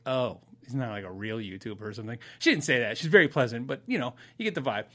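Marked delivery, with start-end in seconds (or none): 0.1-2.2 low, soft imagined letdown; deflate, as if losing interest; imitated quiet disappointment; disappointed. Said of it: "I could see the disappointment".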